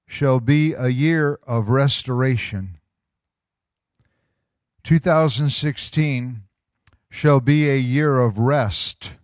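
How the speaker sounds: measured prophetic proclamation, slow with weighty pauses; solemn, slow declaration to a congregation; slow, deliberate reading. Real, not acted. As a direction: read it out slowly so every phrase lands. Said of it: "gonna read it kinda slow".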